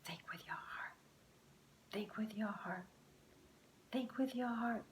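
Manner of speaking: soft unvoiced whisper to himself. A narrator's reading. Luke whispers the phrase to calm himself down. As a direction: breathe it like a secret. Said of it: "He whispered it to himself three times".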